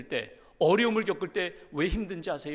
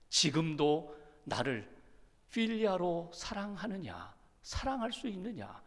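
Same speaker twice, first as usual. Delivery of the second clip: slow, soft pleading question; gentle Jesus asking; 2 semitones lower, 7 dB quieter, slower than usual. Acted. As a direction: ask softly and slowly, meeting him at his level. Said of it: "이렇게 바꾸세요".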